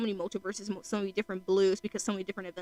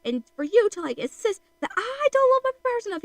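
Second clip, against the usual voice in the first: high-pitched, hammed-up indignation; freaking out; 11 semitones higher, 5 dB louder than usual. Insulted brother insisting on his love for Papyrus, freaking out. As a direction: wail the mock outrage, climbing much higher. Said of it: "he, like, twigs out".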